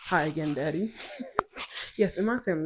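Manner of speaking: usual speaking voice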